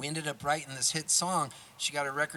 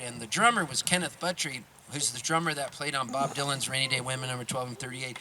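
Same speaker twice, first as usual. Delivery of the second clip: usual speaking voice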